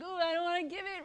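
mock the grudging giver; grudging whine. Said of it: "Don't grudgingly give it".

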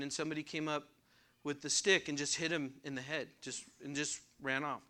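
ordinary delivery

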